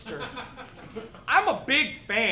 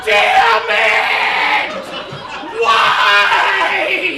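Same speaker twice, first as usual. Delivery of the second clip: much higher, much louder shout of pain; 5 semitones higher, 11 dB louder, much slower than usual. Acted. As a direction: yell out as the sun burns you. Burned by the Melbourne sun.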